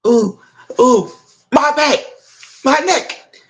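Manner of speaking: hammed-up moaning about back and neck, rising high; mock groaning. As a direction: play the faker clutching his neck. Man in back faking injury.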